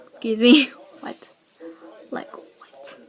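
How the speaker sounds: loud rising Excuse me?; indignant disbelief. Retold or imitated. Friend blames her for rumor.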